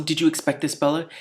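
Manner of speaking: ordinary delivery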